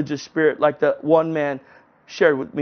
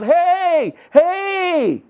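yelling out; 12 semitones higher than usual. Retold or imitated. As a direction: call out to the man far away. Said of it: "and I yelled out".

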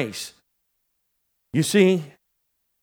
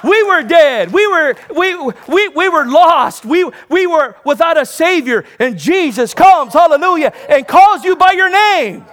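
higher and louder, rising preacher's cry; full-force preaching; 7 semitones higher, 5 dB louder than usual. Acted. Preacher rousing the congregation, loud and climbing. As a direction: build it up and cry it out to the church.

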